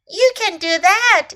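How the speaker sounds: high, loud encouragement; crying out. A narrator's reading. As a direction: exclaim it to cheer them up. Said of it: "cried Snake".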